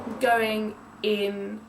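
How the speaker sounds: normal talking voice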